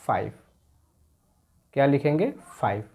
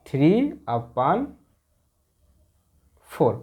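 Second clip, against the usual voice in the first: slow reading; much slower than usual. Shown as a demonstration. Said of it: "ऐसे पढ़ेंगे".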